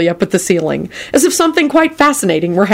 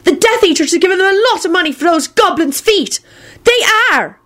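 put-on very high-pitched insistent girl's voice; stubborn insistence; 13 semitones higher than usual. A narrator's reading. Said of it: "Luna hollered out stubbornly".

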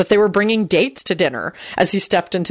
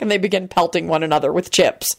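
ordinary delivery

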